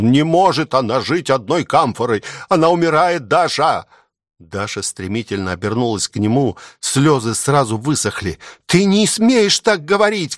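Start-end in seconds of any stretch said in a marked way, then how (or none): 0.0-3.9 high, melodramatic exclamation; irritated theatrical cry. A narrator's reading. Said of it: "раздражённо и тем же точно театральным голосом воскликнул". 8.7-10.4 very high-pitched, outraged cry; indignant cry. A narrator's reading. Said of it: "крикнула она".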